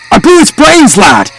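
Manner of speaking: high-pitched alarmed yell. A narrator's reading. Shooter sees the sheep still moaning, high yell. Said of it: "I yelled".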